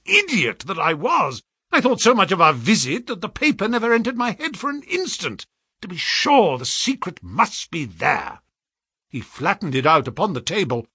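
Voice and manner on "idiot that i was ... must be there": high, agitated self-reproach; excited outburst. A narrator's reading. Holmes berates himself over the paper, excitedly. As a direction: scold yourself in high excitement. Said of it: "in a paroxysm of excitement".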